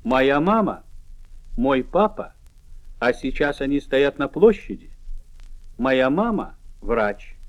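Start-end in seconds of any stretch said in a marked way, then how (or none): none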